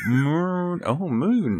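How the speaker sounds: cow voice. Retold or imitated. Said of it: "And he went".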